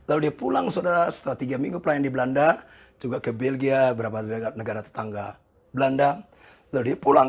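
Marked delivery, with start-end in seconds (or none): none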